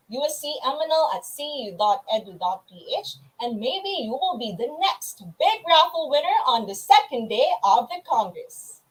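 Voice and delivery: ordinary talk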